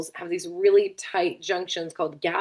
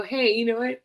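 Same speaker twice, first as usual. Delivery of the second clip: yawning while talking; 6 semitones higher than usual. Real, not acted. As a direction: talk through a yawn. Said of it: "Sorry, I keep yawning".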